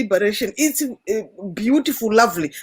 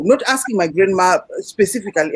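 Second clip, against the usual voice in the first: ordinary delivery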